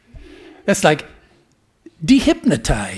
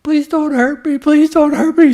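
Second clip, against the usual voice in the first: much higher, louder pleading little sheep; frightened little lamb; 11 semitones higher, 8 dB louder than usual. Acted. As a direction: beg for mercy in a small high voice. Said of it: "said the sheep lion".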